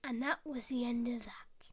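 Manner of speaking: quiet, low whispered ending; the storyteller closing the fable in a hush. A narrator's reading. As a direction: let it die away in a whisper.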